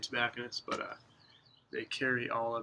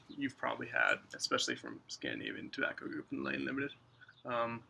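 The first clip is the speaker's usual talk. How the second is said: talking around pipe stem; pipe in mouth. Real, not acted. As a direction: lips clenched on pipe. Seen 2: Pipe stem held between lips until removed at about 3.5 s.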